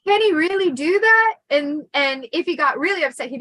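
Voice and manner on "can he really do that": put-on high, loud alarmed little kid; small child half-believing the threat; worried kid. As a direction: ask wide-eyed and scared.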